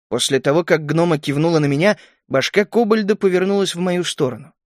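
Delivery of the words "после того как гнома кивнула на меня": ordinary delivery, nothing marked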